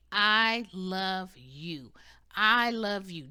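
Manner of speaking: very slow, loud I love you; tender affirmation. Shown as a demonstration. Demonstrating a naked mirror affirmation, slow and heartfelt. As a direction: pour love into each word. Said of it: "look at your body and say".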